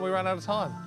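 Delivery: usual speaking voice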